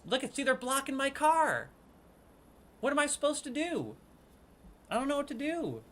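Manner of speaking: high, helpless whine to the viewers; high-pitched complaint. Real, not acted. Player complaining that they're blocking the car, high whine. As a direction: complain high, lifting each line then dropping the last word.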